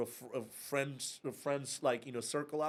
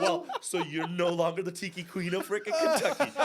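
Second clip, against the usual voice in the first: teasing jab, higher and louder, laughing through it; mock-smug laughing put-down; laughing taunt; 7 semitones higher, 7 dB louder than usual. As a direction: gloat at her while laughing. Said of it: "I'm like".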